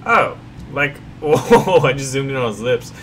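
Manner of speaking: ordinary talk, nothing marked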